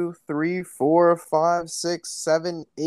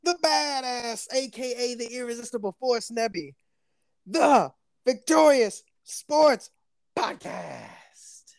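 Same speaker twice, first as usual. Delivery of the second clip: ringmaster; 6 semitones higher than usual. Pretend. Announces the podcast like ring announcer.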